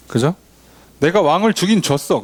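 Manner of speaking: ordinary talk, nothing marked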